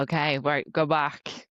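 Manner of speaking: ordinary talk, nothing marked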